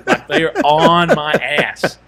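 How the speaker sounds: slow, punched "on my ass"; emphatic retelling. Retold or imitated. Man retelling a locker room ambush, emphatic. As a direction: stretch out ON MY ASS, slow and loud.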